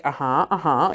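low, noncommittal imitated uh-huh; retold neutral listening noises; mulling it over. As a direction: murmur along as if just considering it. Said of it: "just mulling it over".

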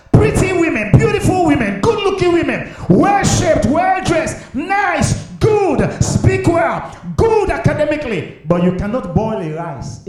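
high, loud listing from the pulpit; fiery preaching. Real, not acted. Pastor lecturing young women about marriage, fiery preaching. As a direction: build the list, then drop the punchline.

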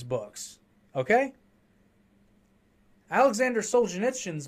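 indignant loud tirade; heated rant. Real, not acted. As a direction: hammer each point home, fed up. Heated rant about real book bans.

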